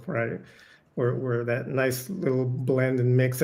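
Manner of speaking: ordinary talk, nothing marked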